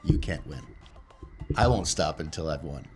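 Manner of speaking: usual speaking voice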